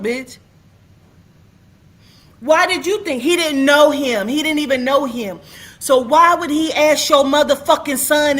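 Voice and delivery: real outrage boiling over at the witness; furious rant. Real, not acted. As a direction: tear into her, shouting every line.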